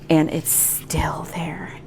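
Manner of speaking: slow whispered complaint. Real, not acted. Vlogger telling viewers about her migraine, whispered.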